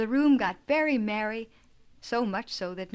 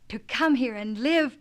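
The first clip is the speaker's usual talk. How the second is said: excited cry; 4 semitones higher, 7 dB louder than usual. A narrator's reading. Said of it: "cried Jasper".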